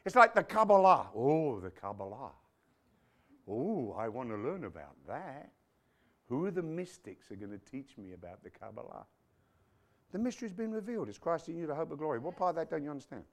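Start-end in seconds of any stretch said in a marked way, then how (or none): 1.1-9.1 hammed-up hushed wonder at secret knowledge; mock fascination. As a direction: act mystically intrigued, trailing away softer. Mocks seekers of secret mysteries.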